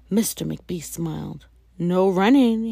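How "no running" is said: louder, playful scolding call. Acted. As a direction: call the rule after her. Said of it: "he called to her".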